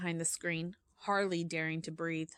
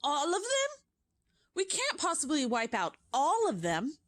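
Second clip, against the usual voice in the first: high, louder shocked witch voice; dismayed witch; 8 semitones higher, 4 dB louder than usual. Acted. A front-row witch reacts to the order, high shocked voice. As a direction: gasp out the disbelief. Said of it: "saying aloud".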